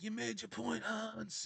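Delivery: high, soft put-on movie voice; movie character impression. Quoting Die Hard to viewers, high impersonated voice. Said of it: "like Hans Gruber said in Die Hard".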